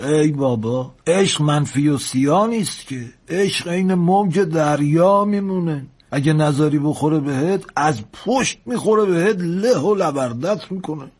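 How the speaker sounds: put-on high, slow old sailor's voice; animated old engineer lecturing the lad; old engineer, philosophizing. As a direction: stretch it out like homespun wisdom on love.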